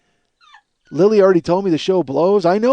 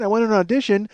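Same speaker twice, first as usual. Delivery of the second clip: ordinary talk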